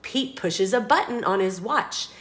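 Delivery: usual speaking voice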